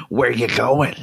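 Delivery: NPC line with a slushy lisp. Pretend. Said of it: "the voice of an N P C".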